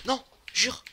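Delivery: gasping high disbelief; shocked gasp. Real, not acted. Shock at game event.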